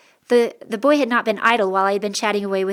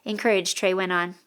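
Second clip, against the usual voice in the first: ordinary delivery, nothing marked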